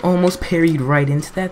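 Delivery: usual speaking voice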